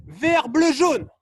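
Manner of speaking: loud, high colour calls; called-out command. Real, not acted. Coach sends players to coloured cones, loud call. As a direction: shout the sequence as an order.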